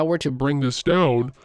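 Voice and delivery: deep, scary formant-shifted voice. Shown as a demonstration. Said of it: "I start to sound really deep and scary".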